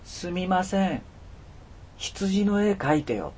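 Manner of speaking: put-on high, slow, drawn-out little boy's plea; mysterious child's voice. A narrator's reading. Strange boy waking a stranded man with a request, mysterious high voice. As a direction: plead slowly in a high child's voice. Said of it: "不思議な声に僕は起こされたのです".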